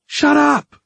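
high, loud panicky snap; sharp retort. A narrator's reading. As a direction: shoot it back, voice leaping up. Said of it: "Giles shot back".

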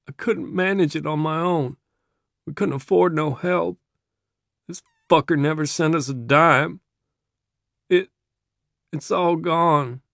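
high, strained, tearful confession; fighting tears. A narrator's reading. Kid explaining the lost farm, fighting tears. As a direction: hold back tears as the pitch climbs. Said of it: "the kid's losing it now, fighting tears".

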